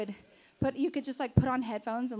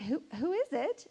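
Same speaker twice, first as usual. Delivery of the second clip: retold startled boyfriend; 4 semitones higher than usual. Boyfriend answers banged door.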